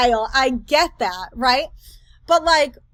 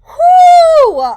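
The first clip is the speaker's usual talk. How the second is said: big exhaled whew, way up high; loud whew; 14 dB louder than usual. Real, not acted. Reacting to the creator's clip with a loud whew. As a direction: blow out a huge whew of disbelief.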